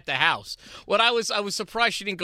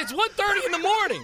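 laughing exasperation; 11 semitones higher than usual. Retold or imitated. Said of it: "I said".

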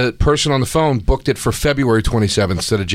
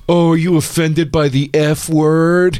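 slow mocking taunt; 4 semitones higher than usual. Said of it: "and I go".

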